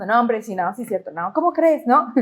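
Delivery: low, quick mock self-talk; talking to oneself. Preacher mimics people who talk to themselves, muttered self-argument. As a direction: imitate someone arguing under their breath with themselves. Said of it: "se hablan a ellos mismos".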